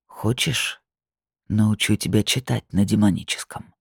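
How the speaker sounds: low purr in the ear. Acted. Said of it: "проурчал он мне на ухо".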